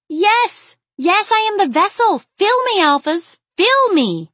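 high, loud theatrical cry; dramatic shout. A narrator's reading. Aziza mocking the ritual with arms wide, shouting dramatically. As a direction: shout it high, as mock-grand proclamation. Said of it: "shouted dramatically".

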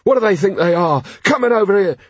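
high-pitched indignant complaint; indignant rioter. Acted. Rioter complaining to commander, indignant. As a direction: grumble with rising indignation.

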